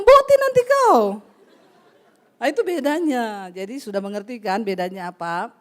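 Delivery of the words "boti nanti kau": high, loud and slow teasing jeer; loud mocking jeer. Preacher voices a man jeering at his friend, high and loud. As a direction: jeer at your buddy, dragging it out.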